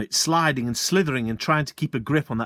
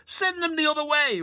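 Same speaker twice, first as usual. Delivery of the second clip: bellowed. Acted. Landowner furiously bellowing at the sergeant. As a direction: rage at the fool. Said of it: "bellowed, jumping up and down".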